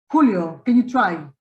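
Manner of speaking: ordinary delivery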